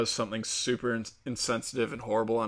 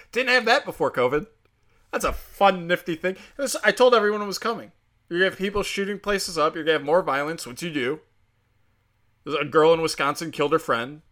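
heated sarcastic rant; 6 semitones higher, 3 dB louder than usual. Real, not acted.

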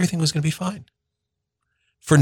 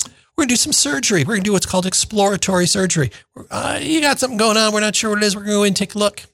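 breezy doctor; 9 semitones higher, faster than usual. Retold or imitated. Doctor casually announcing surgery.

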